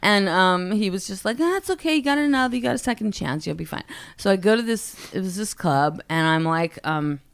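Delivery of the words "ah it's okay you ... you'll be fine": soft, higher-pitched soothing, easing down at the end; light, hushed consoling voice; imitated reassurance. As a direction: gently brush the worry away, as a quoted manager. Voices manager reassuring her.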